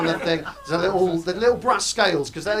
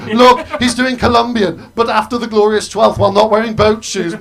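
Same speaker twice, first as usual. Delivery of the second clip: loud posh jeer at an outsider, a touch higher; posh sneering shout; 5 semitones higher, 12 dB louder than usual. Retold or imitated. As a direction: call him out loudly to the room. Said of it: "And look".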